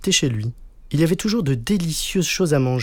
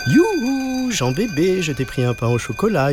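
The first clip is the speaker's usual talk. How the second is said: high hooted call, settling as it goes on; mother calling out; 8 semitones higher than usual. A narrator's reading. Mother hails her son in front of everyone, calling out. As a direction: sing out a high "Youhou", then call the boy. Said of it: "hurla sa maman devant tout le monde".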